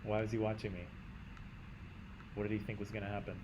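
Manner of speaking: usual speaking voice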